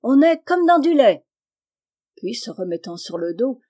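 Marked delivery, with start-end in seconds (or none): 0.0-1.2 high and loud, delighted; crying out. A narrator's reading. Wife in the sea calls back to her husband, loud and high. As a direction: sing it out across the water. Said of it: "cria la jeune femme".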